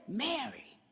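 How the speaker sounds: soft tender call. Retold or imitated. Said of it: "the moment he says".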